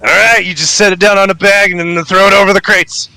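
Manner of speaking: high, fast bandit voice; bossy toll-keeper. Acted. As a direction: rattle off handover orders in a high voice.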